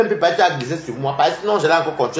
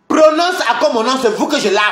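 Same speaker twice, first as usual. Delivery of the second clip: higher and louder, warning them off; angry outburst; 6 semitones higher, 3 dB louder than usual. Real, not acted. Streamer warning Lolo and Flore, loud and angry. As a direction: flare up at the ones who say his name. Threatening rivals on a live.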